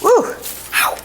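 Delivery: high yelp then laugh; ouch, burned. Real, not acted. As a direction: jump at the heat, then laugh. Touching very hot stromboli.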